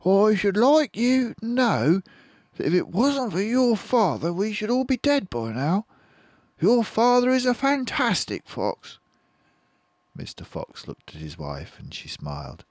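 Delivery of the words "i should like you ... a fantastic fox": high, loud admiring voice; proud fox mother. Acted. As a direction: praise the father to the cubs. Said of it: "Missus Fox said to her children".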